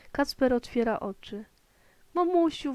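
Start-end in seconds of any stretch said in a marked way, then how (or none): 2.1-2.7 higher, relieved call to mum. A narrator's reading. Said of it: "woła". (wołanie z ulgą, wyżej)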